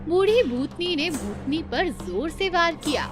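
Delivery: plain reading voice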